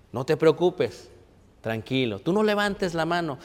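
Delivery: reassuring Jesus. Acted. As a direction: gently soothe a frightened friend, barely above a murmur.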